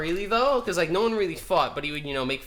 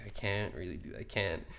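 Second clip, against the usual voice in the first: much softer, holding in a laugh; stifled laugh; 10 semitones lower, 13 dB quieter than usual. Retold or imitated. Student trying not to laugh, soft stifled giggle. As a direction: barely keep the giggle in. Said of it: "kinda laughing".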